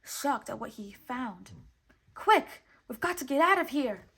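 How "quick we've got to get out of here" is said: urgent loud yell, jumping high. A narrator's reading. Jiminy urging Pinocchio to flee the island, loud yell. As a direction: shout the warning to flee. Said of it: "yelled Jiminy".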